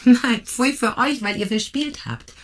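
cheeky victory crow; teasing triumph. Acted. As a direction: rub it in playfully. Effi teasing the losers.

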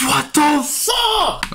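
high, very slow, stretched-out outrage; real frustrated groan; drawn-out disbelief. Real, not acted. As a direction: drag every word out in shock. After getting broken in game.

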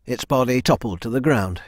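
ordinary delivery, nothing marked

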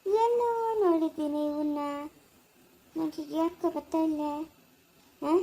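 quiet aside. Acted. Puzzled aside to self.

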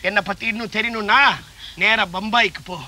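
ordinary delivery

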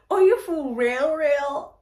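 loud, stunned are you for real; shocked disbelief. Retold or imitated. Reacting to his confession of feelings, loud shock. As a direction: hit it like you can't believe him.

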